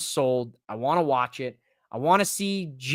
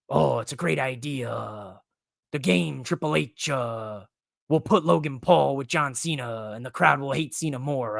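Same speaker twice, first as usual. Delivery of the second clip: Triple H impression; 4 semitones lower, 3 dB quieter than usual. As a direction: drag it out like a pompous boss. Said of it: "greatest impressions ever".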